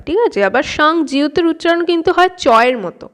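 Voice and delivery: ordinary delivery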